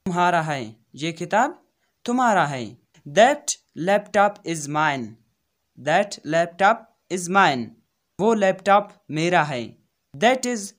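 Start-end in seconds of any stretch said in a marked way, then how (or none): 3.1-5.1 very slow, stretched out; deliberate classroom pace; slow teaching read. Shown as a demonstration. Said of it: "repeated for students". 5.8-7.6 very slow, stretched out; deliberate classroom pace; slow teaching read. Shown as a demonstration. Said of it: "repeated for students".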